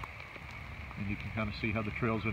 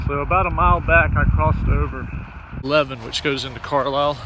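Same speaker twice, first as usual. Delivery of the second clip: talking over wind; 8 dB louder than usual. Real, not acted. Loud wind or rain noise.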